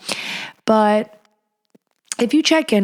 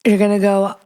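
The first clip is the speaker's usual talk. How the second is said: normal talking voice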